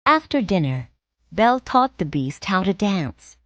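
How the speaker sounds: usual speaking voice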